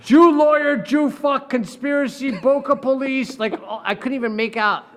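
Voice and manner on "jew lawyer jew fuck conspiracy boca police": loud, high mock reading of the hate note; reading out the letter. Lawyer reading a hateful letter to friends, loud and high. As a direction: spit out the slurs like reading them aloud.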